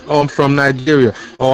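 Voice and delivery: normal talking voice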